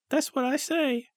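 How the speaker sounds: high-pitched prompt to a silent crowd. Acted. Comedian prompting the unresponsive audience, high comic voice. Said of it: "And he went".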